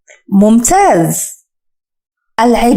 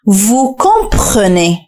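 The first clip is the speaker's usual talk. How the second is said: "Vous comprenez" very slow and deliberate; slow drill; much slower than usual. Shown as a demonstration. Teacher drills the phrase for viewers to echo, slowly. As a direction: stretch out "Vous comprenez" for learners. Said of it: "سأبدأ بنطق المقاطع الصوتية".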